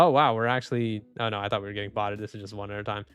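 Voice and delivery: usual speaking voice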